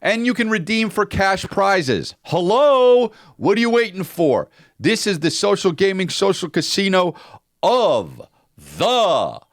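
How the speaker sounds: high-pitched, drawn-out announcer shout; over-the-top ad-read hype. Acted. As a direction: sell it big, building to the final word.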